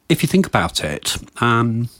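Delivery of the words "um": ordinary talk, nothing marked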